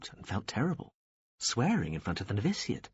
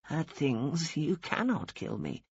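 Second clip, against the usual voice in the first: put-on pitched up, slow and dry old nun's voice; defiant old woman; 6 semitones higher, slower than usual. A narrator's reading. Dying old woman defying the angel of death, dry and stubborn.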